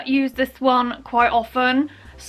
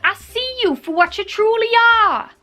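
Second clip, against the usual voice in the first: high, put-on movie character voice; She's the Man quote; 8 semitones higher than usual. Quoting a favourite film line to viewers, high theatrical voice. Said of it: "It's from She's the Man".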